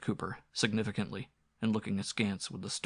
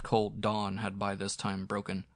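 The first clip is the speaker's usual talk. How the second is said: ordinary delivery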